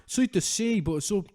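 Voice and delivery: dopey fan voice. Retold or imitated. Mocking a Rogan fan marvelling at space, high put-on dopey voice. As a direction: gush like someone who just discovered space. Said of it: "And it's just like".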